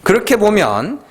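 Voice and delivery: ordinary talk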